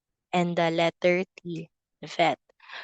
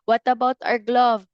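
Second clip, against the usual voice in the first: ordinary talk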